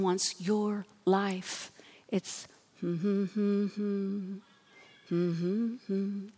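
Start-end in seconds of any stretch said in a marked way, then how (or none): 2.1-6.3 soft, far-off mournful humming; haunting hum of grief. Shown as a demonstration. Preacher hums the haunting sound of grief. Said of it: "that haunting sound of grief".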